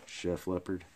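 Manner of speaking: ordinary delivery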